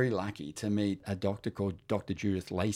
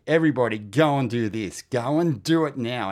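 loud mock rallying call to everyone; hammed-up loud urging to all his friends; 4 semitones higher, 9 dB louder than usual. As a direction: shout it like a preacher urging the crowd.

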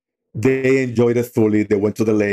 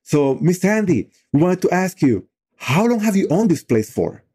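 put-on higher, innocent kid voice asking; curious young boy; 8 semitones higher than usual. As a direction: raise the pitch like a naive teenager. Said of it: "the other one says".

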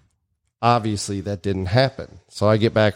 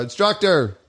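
higher-pitched call for the instructor; 8 semitones higher, slower than usual. Retold or imitated. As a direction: imitate calling across the range. Calling out for the instructor.

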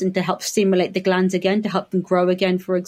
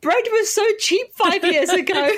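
mock weeping; 11 semitones higher than usual. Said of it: "They start weeping at the price of bread".

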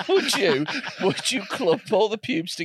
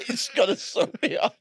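laughing; 8 semitones higher than usual. Acted. Laughing repeat of quote.

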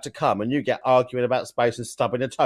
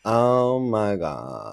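very slow LA vocal fry; creaky, drawn-out valley girl; Kim Kardashian; much slower than usual. Acted. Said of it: "it's that little vocal fry".

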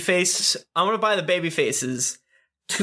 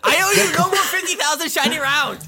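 high, loud, laughing cry of a greedy crook; laughing mock demand; 12 semitones higher, 4 dB louder than usual. Gleeful crook laughing at the debtor, hammed-up. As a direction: cackle out the money owed. Player voices creditor, laughing.

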